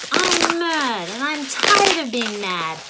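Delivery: mock angry outburst. Shown as a demonstration. Teacher voicing a child, hammed-up frustrated venting. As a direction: act out a kid fed up with being mad. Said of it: "project it out".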